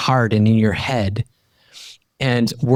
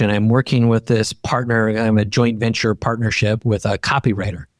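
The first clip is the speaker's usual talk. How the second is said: usual speaking voice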